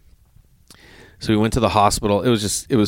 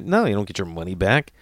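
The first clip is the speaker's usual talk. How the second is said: ordinary delivery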